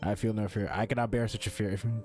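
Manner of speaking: usual speaking voice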